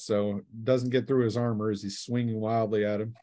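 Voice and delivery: ordinary talk, nothing marked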